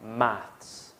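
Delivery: maths said the British way, with a clear final s; British pronunciation. Shown as a demonstration. British teacher modelling maths for students to repeat. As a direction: model maths as UK English speakers say it.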